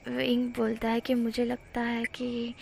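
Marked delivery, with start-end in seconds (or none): 1.1-2.5 drawn out and much softer, almost breathed; slow soft line. Acted. Wing leaning in close to Wangji, slow and soft. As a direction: ease in slowly and drop the voice. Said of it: "वो इस तरह से बोल रहा था".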